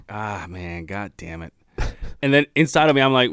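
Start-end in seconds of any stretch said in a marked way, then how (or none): 0.1-1.5 low, soft sinking mutter; mock dismay. Podcaster recalling his reaction to a serious new listener, dismayed. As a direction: let a retold curse deflate quietly.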